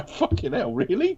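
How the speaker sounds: high-pitched incredulous reaction; exaggerated can-you-believe-it tone; mock disbelief. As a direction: act baffled, climbing high on the question. Acted disbelief at online reaction.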